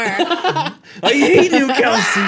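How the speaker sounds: laughing. Real, not acted. Laughing mock complaint.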